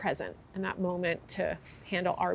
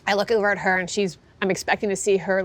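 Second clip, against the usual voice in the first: ordinary talk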